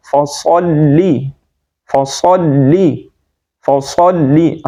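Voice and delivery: "فصلی" slow with thick s and rounded o-like vowel; heavy saad. Shown as a demonstration. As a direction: fill the mouth on the s of "فصلی". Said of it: "صاد کو موٹا پڑھیں گے".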